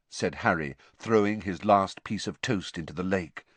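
usual speaking voice

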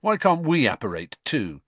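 mildly sulky protest; grumpy. A narrator's reading. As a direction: complain like a put-out teenager. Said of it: "said Fred grumpily".

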